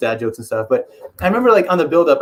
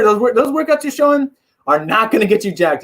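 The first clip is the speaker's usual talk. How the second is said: ordinary talk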